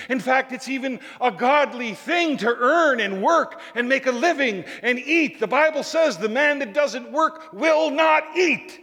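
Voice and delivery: high, emphatic pulpit delivery; forceful preaching. Real, not acted. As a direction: drive it home, rising to the end.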